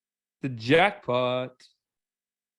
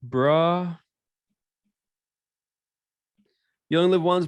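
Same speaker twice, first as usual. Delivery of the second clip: drawn-out lazy bro voice; yolo bro; 2 semitones higher, much slower than usual. Pretend. Mocking the yolo type, lazy bro drawl. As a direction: drag it like a carefree dude.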